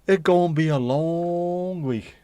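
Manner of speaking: slow, loud, drawn-out; mock weary groan. Complaining to chat about the busy week, drawn-out.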